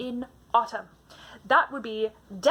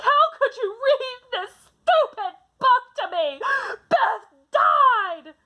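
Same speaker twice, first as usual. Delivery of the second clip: put-on much higher, louder crying yell, reliving a childhood tantrum; crying and screaming; 13 semitones higher, 5 dB louder, slower than usual. Child screaming at mom over Beth's death, sobbing loudly. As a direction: scream it through tears like a heartbroken kid. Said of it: "jumping on the bed and crying and screaming".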